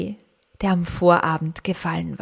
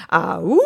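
long rising "ah-oo" howl; wolf howl; 4 dB louder than usual. A narrator's reading. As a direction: howl along like a wolf's supporters. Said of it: "heulten die anderen". (langgezogenes Wolfsgeheul)

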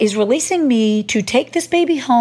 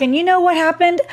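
ordinary talk, nothing marked

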